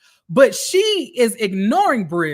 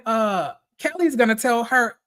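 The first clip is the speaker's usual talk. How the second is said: usual speaking voice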